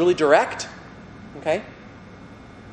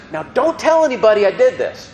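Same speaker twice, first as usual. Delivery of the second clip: higher, louder voicing of Jesus; Jesus' voice; 7 semitones higher, 4 dB louder than usual. Teacher voicing Jesus after a miracle, higher and louder. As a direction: act out Jesus asking for secrecy. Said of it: "he says".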